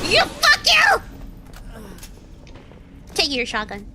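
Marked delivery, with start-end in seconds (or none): 0.0-1.0 high loud angry shout at the enemy; furious yell. Real, not acted. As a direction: curse out the player who got you. Shouting at the enemy who killed him.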